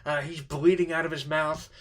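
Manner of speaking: normal talking voice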